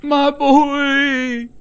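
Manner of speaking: high, loud wail of grief; a father's howl of loss; anguished scream. Acted. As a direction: scream it over his dead son. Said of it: "starts screaming".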